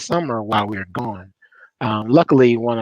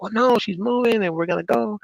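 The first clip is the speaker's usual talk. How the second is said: lightly imitated child, higher pitch; child insisting; 10 semitones higher than usual. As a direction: retell a kid's insistence in a raised voice. Retelling son insisting on the move.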